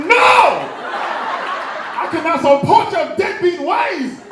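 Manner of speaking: loud, high comic shout; outraged refusal. Retold or imitated. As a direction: yell the refusal at a deadbeat dad, slowing down. Outraged refusal to absent dad.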